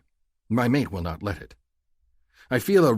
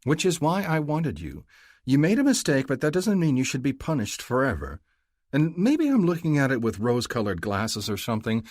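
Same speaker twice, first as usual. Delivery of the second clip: higher female voice, building and speeding at the end; Mahdi reassuring; 7 semitones higher, 5 dB louder than usual. A narrator's reading. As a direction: pour it out to him. Mahdi reassures her mate.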